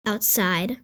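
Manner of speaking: plain reading voice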